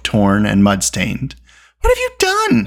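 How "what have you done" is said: high, alarmed reproach; urgent, high-pitched scolding; alarmed cry. A narrator's reading. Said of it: "he cried".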